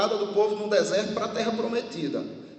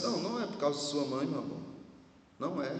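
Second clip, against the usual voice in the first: soft reassurance; 4 semitones lower, 7 dB quieter, faster than usual. Retold or imitated. Comforting a crying little girl.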